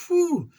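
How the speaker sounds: very high mock relief whistle; relieved whew. Spotting a buddy before the fight, big relief. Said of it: "So I'm like".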